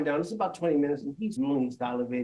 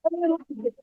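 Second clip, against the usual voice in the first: much higher, louder squeaky protest; defensive guy; 6 dB louder than usual. Retold or imitated. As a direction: whine the excuse up high. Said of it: "He goes".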